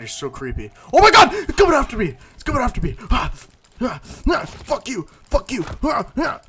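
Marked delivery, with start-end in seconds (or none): 0.9-4.4 high, loud panicked yells; screaming as something chases you; terrified shouting. Real, not acted. Player fleeing a cannibal, shouting in panic. 4.4-6.4 shouted curse, high and strained; angry high yell. Real, not acted. Player hitting back at a cannibal, yelling.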